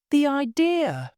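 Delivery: high, scandalised protest; shocked at the very suggestion; outraged exclamation. A narrator's reading. Maid rejecting any kinship with her mistress, outraged. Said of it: "she cried".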